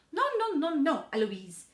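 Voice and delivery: high, loud volley of no's; nanny yelling. A narrator's reading. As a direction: yell rapid objections, dropping on the name. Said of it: "Nanny comes in yelling".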